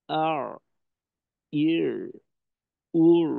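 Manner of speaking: আর ইর উর with the Ain held and voice kept flowing; Ain with sukun demo. Shown as a demonstration. Teacher showing students Ain with jazm, sound held. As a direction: Ain with jazm in আর, ইর, উর, not choked off. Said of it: "তারপর জজম দিয়ে".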